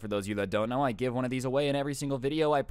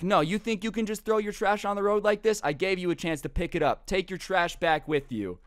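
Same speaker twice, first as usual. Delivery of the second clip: angry lecture; 7 semitones higher than usual. Retold or imitated. Truck guy lectures litterer.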